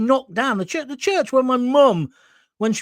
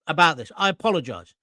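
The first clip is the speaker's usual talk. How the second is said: normal talking voice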